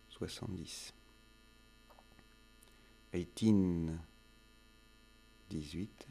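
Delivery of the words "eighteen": put-on "Eighteen" stressed on teen, eigh-TEEN said loud; word stress. Shown as a demonstration. Teacher drilling number stress, eigh-TEEN stressed clearly. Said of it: "l'accent tonique en anglais".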